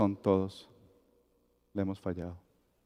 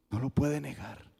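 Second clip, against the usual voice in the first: softer, dropping no lo puede negar; quiet; 9 dB quieter than usual. Acted. Preacher to the congregation, hushed and certain. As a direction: let the truth settle, softly. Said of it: "preached refrain, quiet take".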